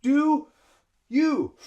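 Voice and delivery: "Do", breathe, then "you"; high, full "Do" and "you", split by a breath; two separate breaths. Shown as a demonstration. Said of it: "breathe two times".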